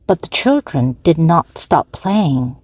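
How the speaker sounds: ordinary delivery, nothing marked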